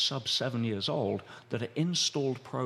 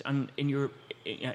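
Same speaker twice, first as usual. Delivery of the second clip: normal talking voice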